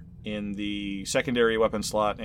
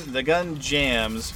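ordinary delivery

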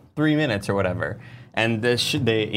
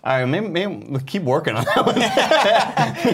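higher, slower tactful suggestion through laughter; imitating Greg; 8 semitones higher, slower than usual. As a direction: gently let him down, half laughing. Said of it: "Greg was like".

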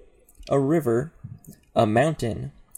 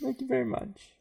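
mock gracious; 14 semitones higher, 3 dB quieter, faster than usual. Mock-gracious bow after praise.

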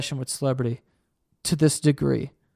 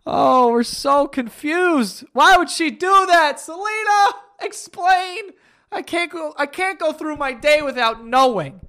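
much higher, slow, whiny mock fan; mock distraught fan; 11 semitones higher, slower than usual. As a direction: ham up a fan desperate for answers. Mocking confused fans.

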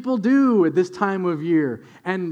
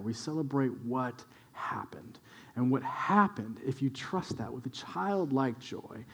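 lower, softer preaching, close and intimate; hushed sermon; 7 semitones lower, 8 dB quieter than usual. Acted. Pastor urging listeners to find Christmas joy, hushed and low. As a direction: draw the congregation in quietly.

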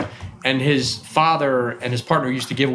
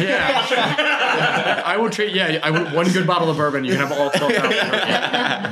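chuckling through a joking offer, higher; laughing; 5 semitones higher, slower than usual. Real, not acted. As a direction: laugh while bargaining. Joking offer to trade cards.

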